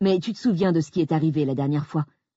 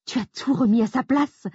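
high, accusing protest; 8 semitones higher than usual. Acted. Grace accusing her husband in the lobby. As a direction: throw the blame at him. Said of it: "ai-je protesté avec des accents accusateurs".

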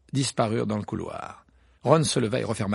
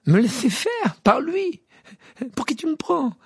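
indignant outrage; 5 dB louder than usual. Acted. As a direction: scoff in offended disbelief. Ron indignant.

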